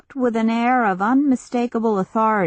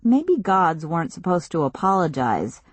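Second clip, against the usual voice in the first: ordinary delivery, nothing marked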